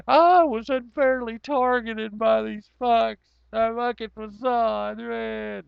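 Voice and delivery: high, slow, hammed-up indignation; mock outrage. Streamer mocking his own ban, high and slow. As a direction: whine it like a fake victim.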